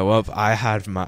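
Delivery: ordinary talk, nothing marked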